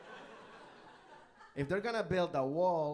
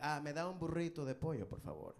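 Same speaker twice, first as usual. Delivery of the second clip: Spanish order; 4 dB quieter than usual. Retold or imitated. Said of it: "order in Spanish".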